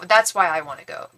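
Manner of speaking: ordinary talk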